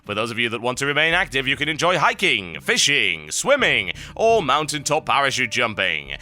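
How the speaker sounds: advert read. Acted. Host reading a listener's mountain retreat advert, salesman-like ad read. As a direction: pitch it like a travel commercial. Reads a holiday advert postcard.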